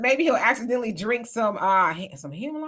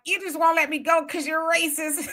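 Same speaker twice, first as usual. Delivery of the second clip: higher mock outburst at the teacher; angry retort; 7 semitones higher than usual. Student accuses substitute teacher of racism, mock angry outburst. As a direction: snap back at the substitute, half joking. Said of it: "So I got mad and I was like".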